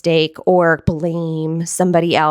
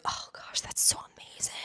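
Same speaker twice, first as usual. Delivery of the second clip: whisper; 19 dB quieter than usual. Acted. Whispered amazement aside.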